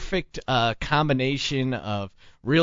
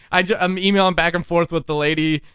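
ordinary talk

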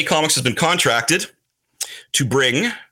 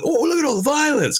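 high-pitched mock gawking; put-on high wowed voice; mock awe at violence; 13 semitones higher than usual. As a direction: play an easily impressed viewer, pitched way up. Mocking imagined teen reaction to gore.

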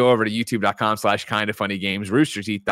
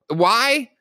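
incredulous; 8 semitones higher, 3 dB louder, slower than usual. Real, not acted. Incredulous at cohost.

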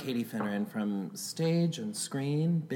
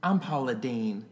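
put-on Southern accent; 4 semitones lower, slower than usual. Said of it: "Do Southern right now".

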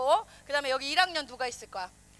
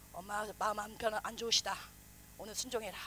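hushed warning; 7 semitones lower, 10 dB quieter than usual. Retold or imitated. Dad whispering warning to kids.

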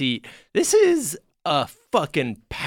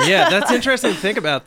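laughing; 9 semitones higher, 4 dB louder, faster than usual. Real, not acted.

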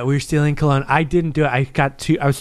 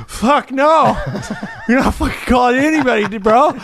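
laughing refusal; 7 semitones higher than usual. Retold or imitated. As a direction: shut the friend down while cracking up. Friends refusing through laughter.

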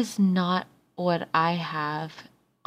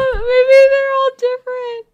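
squeaky high voice; 7 dB louder than usual. Acted. Sudden squeaky high take.

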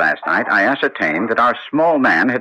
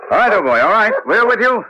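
urging on the dog; 9 semitones higher than usual. Acted. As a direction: egg the dog on as he pulls ahead.

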